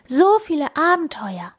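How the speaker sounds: high, marvelling voice; amazed. A narrator's reading. Hare dreaming of many adventures, high amazement. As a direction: marvel with pitch well up. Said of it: "staunte der Hase".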